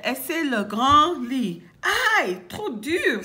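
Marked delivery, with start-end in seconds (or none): 2.2-3.2 mildly raised cry of discomfort; pained cry. Acted. Boucle d'Or tries the hard bed, mild pained cry. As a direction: yelp lightly, a little pained. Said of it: "s'écrie-t-elle".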